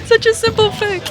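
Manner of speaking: giggling through the words; laughing. Real, not acted. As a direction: laugh while you say it. Laughing at the fixed audio.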